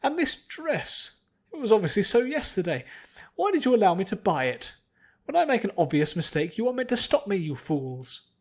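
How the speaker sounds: high, loud and haughty, scolding the servants; snooty lady scolding. A narrator's reading. Noblewoman berating her servants, high and loud. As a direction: berate them like fools beneath her. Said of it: "a snooty, pretentious voice".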